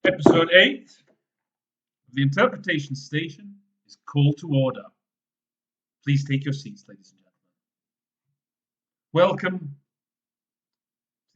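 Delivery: loud, showy show-opening announcer. Acted. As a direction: present it like a ringmaster. Host opens show like announcer.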